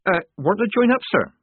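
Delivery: high, hesitant recruit voice; a nervous girl faking a boy's courage; nervous young recruit. Acted. Nervous girl posing as boy.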